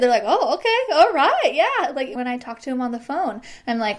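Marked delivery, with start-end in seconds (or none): 0.3-1.9 excited client. Retold or imitated. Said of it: "And they're excited".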